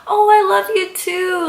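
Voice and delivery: high, sweet imitation of the idol; sweet reply. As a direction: answer the fan sweetly. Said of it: "she's like".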